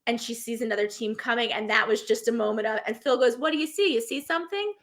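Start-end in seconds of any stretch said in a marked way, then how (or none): 3.4-4.7 higher, rising, eager questions; probing questions. Retold or imitated. Host Phil to waiting contestant, imitated rising questions. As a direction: lift each question upward, curious.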